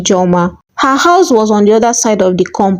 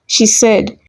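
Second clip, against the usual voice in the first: ordinary delivery, nothing marked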